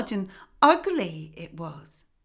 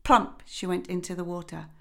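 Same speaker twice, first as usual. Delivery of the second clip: plain reading voice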